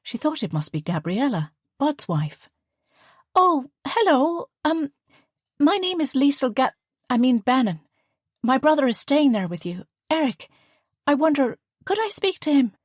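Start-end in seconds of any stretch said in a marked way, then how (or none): 3.3-12.8 Liesl fumbles coins and asks for her brother; flustered young woman. A narrator's reading.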